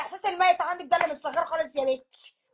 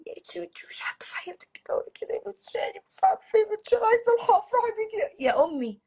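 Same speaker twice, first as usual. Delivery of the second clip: whispered sob rising into a higher wail; weepy mother; 6 semitones higher than usual. Acted. Mother wailing to her daughter that she wants to see her married. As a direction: cry it out, pleading for the wedding. Mother weeping, pleading to marry.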